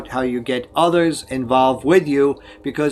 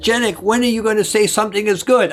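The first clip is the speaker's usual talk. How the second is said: higher, quicker, exasperated mock challenge; imitated roommate's complaint; 6 semitones higher, faster than usual. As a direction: play a roommate pressing for praise, pitched up and brisk. Roommate challenges his stingy praise.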